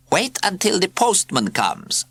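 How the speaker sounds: high, slow purring cat voice. A narrator's reading. Cat prefect teases the children, slow and purring. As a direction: purr it out like a smug cat. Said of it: "purred Zanzibar".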